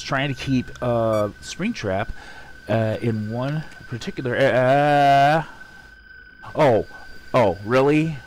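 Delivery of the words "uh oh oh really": startled. Real, not acted. Jump scare reaction.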